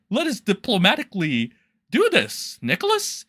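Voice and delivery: mock a sellout agreeing sweetly; mocking simper. J-Stock voiced caving to Nicholas, mocking simper. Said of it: "was like".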